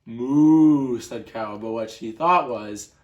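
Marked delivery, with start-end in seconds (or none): 0.0-1.0 loud mooing cow. Acted. Ruben as Cow answering Duck, mooing. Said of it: "we have to use the voices".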